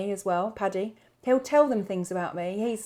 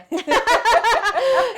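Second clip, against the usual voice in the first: loud, high burst of laughter; big laugh; 10 dB louder than usual. Real, not acted. Guest laughing about naughty Gaelic words, big laugh. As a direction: crack up at your own joke.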